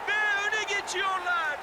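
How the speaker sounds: yelled goal call, pitch way up; screaming football commentator. Acted. Goal scored in game commentary. (gol anında bağıran spiker)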